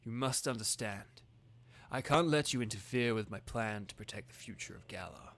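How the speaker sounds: understated menacing Chairman Rose; reading Rose's lines. Acted. Reads Rose's villain lines.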